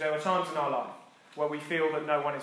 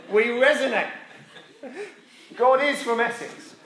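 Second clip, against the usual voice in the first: laughing joke; 6 semitones higher, 5 dB louder than usual. Real, not acted.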